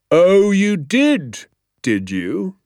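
high, very slow, then dropping; slow sarcastic challenge from a strict teacher; menacing sarcasm. Acted. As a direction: draw it out with mock surprise. Angry teacher rounds on second pupil.

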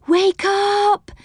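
high squeal, weight on "up", "up" held long. Acted. Silky waking the children and Moonface, squealing with "up" stretched. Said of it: "squealed Silky".

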